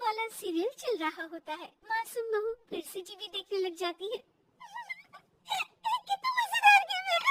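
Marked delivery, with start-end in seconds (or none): 6.1-7.2 high, quick, tickled exclamation; laughing delight. Acted. Mother-in-law enjoys the TV serial, laughing delight. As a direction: blurt it out high through laughter. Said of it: "जोर जोर से हंस रही होती है".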